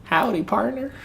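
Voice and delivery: very high cowboy Southern twang; hammed-up Southern cowboy squeal; mock Southern accent. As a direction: tease with a squeaky Southern drawl. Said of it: "it's my slight southern accent".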